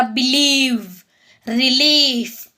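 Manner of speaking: "बिलीव्ह", "रिलीफ" with a drawn-out ee; long "ई" sound. Shown as a demonstration. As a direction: stretch the second syllable of "बिलीव्ह" and "रिलीफ". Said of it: "असे उच्चार होऊ शकतात बघा". ("बिलीव्ह", "रिलीफ" मधला ई लांबवून)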